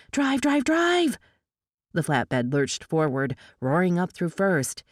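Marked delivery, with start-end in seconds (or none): 0.1-1.2 high, urgent yell, climbing. A narrator's reading. Tinker races across the trailer to the driver, urgent yell. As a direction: yell it rapid-fire without much volume.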